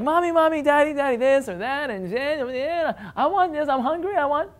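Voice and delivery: put-on high-pitched, whiny child pestering a parent; imitating a needy, whining child; whining little kid. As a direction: whine it in a run-on, mock little-kid voice. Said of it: "when it comes to whining".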